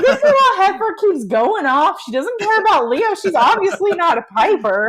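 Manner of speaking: high, loud indignant rant; indignant sister. Acted. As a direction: scold the other sister with contempt. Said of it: "She's like".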